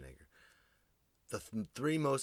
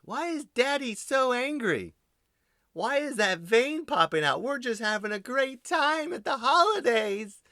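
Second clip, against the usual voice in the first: put-on high, loud little kid asking innocently; childlike kid voice; 10 dB louder than usual. Child asking why daddy looks so angry, high and loud. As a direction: play the kid puzzled by daddy.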